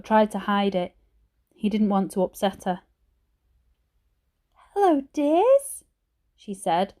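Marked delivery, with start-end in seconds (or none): 4.7-5.8 put-on high, slow, croaky old lady voice; a frail grandmother in a hospital bed, slurring slightly; croaky old granny. A narrator's reading. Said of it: "Her voice was croaky and her speech a little slurred".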